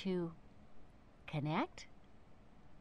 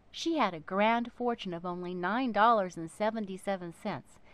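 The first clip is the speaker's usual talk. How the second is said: plain reading voice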